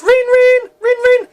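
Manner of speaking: high, loud voiced telephone ringing; imitated phone ring. A narrator's reading. The phone suddenly rings in the house, imitated ringing. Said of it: "Suddenly the phone rings".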